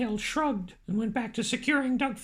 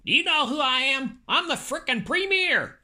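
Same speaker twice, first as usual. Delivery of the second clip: mock yell; 3 dB louder than usual. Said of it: "Andy heard someone yell".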